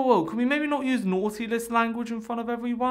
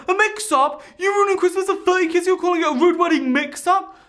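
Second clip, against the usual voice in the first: high, loud indignant screech; hammed-up furious mum; outraged mother; 11 semitones higher, 6 dB louder than usual. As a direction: scream at the staff in disbelief.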